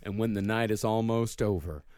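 low, quiet creepy lyric reading; calm psychopath. Acted. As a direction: read it like a calm serial killer. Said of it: "do it in the serial killer voice".

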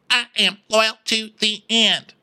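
high, insistent mock declaration; Sutton. Sutton defending herself to Diana, high insistence. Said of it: "I'll be Diana and you be Sutton".

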